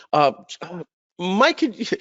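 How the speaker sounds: a pleading teacher asking for help, voiced high; hesitant teacher. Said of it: "And he would say".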